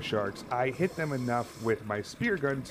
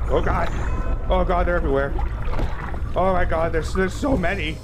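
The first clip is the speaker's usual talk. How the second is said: loud, high, overwhelmed; mounting alarm, voice raised; panicked; 6 semitones higher, 8 dB louder than usual. Real, not acted. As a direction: cry out as they close in from all sides. Overwhelmed by many sharks.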